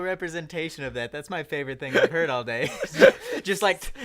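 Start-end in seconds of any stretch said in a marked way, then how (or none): 2.8-3.4 high, loud burst of laughter; shrieking laugh. Real, not acted. Friend cracking up over the salad story, shrieking laugh. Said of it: "high laugh at story".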